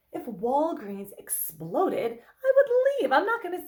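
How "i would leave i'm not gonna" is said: exaggerated mock alarm; emphatic exclamation. Therapist imagining fleeing an exploding Walgreens, exaggerated outburst.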